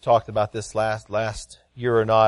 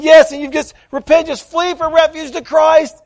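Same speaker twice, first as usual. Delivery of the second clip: loud, high preacher's call; fervent urging; 11 dB louder than usual. Retold or imitated. Father urging his teary son to flee to Christ, loud. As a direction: press him to repent. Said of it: "And I'm saying".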